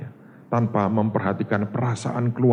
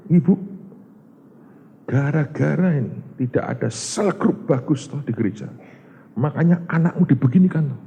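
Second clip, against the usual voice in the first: accusing preacher; 6 semitones higher than usual. Retold or imitated. Preacher accusing the mother publicly.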